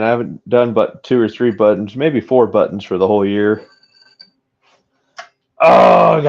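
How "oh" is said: high, loud exasperated oh; frustrated groan. Real, not acted. After ruining the mold, a high loud frustrated oh. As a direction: let the frustration burst out. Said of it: "I'm so frustrated right now".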